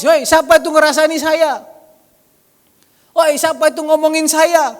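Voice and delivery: loud, high indignant yell; mock shout. Blind man yelling at gossipers, hammed-up angry shout. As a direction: shout it like an offended man demanding who's gossiping. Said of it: "apakah dia teriak?".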